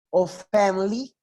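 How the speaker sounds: slow family said as fam-ly, middle i swallowed; family with the i dropped. Shown as a demonstration. Teacher modelling family without the i for students. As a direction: say family as two beats, famly, no i. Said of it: "vamos a omitir el sonido de la I".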